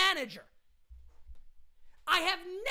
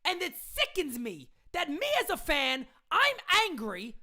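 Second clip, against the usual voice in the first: usual speaking voice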